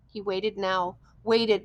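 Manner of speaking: ordinary delivery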